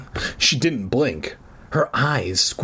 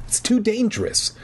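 insistent; 10 semitones higher than usual. A narrator's reading. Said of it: "she insisted".

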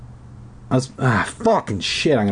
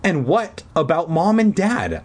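higher, pressing challenge; demanding; 8 semitones higher than usual. A narrator's reading. Sister challenges brother about parents, demanding. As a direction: push back with the question. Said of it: "I demanded".

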